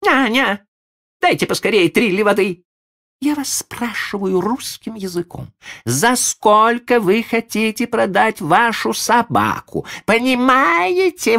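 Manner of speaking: high, loud commanding lady; shrill lady. Acted. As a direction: bark orders like a frantic mistress. Lady shouting orders.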